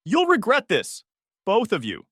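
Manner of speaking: high, loud threat at the door; shouting. A narrator's reading. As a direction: yell it back as you're pushed out. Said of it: "she shouted".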